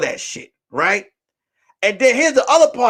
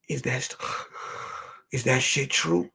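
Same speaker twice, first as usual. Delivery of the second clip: low, breath-filled murmur; secretive question; 6 semitones lower, 11 dB quieter than usual. Retold or imitated. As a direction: imitate her asking softly, in confidence. Teresa approaching him privately at night.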